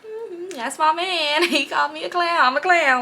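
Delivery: high, drawn-out cheerful imitation; mock smiling contentment. Girlfriend brushing off being called a clown, mock blissful smiling. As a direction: smile through it in a high, slow voice. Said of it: "She was always just smiling".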